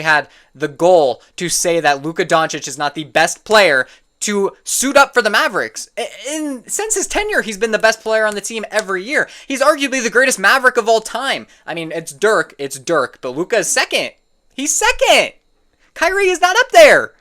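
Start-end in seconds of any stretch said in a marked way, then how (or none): 6.0-17.1 getting heated. Real, not acted. Ranting about a bad basketball take, getting heated. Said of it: "Oh, I'm getting heated".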